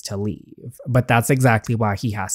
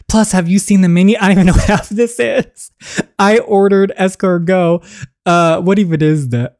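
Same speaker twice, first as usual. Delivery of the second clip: exasperated outburst; 6 semitones higher, 4 dB louder than usual. Acted. Bewildered by the menu.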